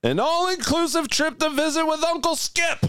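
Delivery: high-pitched sustained proclamation; grand announcing. Pretend. Host announcing a dream trip with Uncle Skip, high proclamation.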